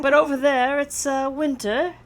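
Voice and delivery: lightly imitated Australian friend, hesitant delivery; attempted Australian accent. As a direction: try an Australian accent, not very well. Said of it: "I can't do an Australian accent".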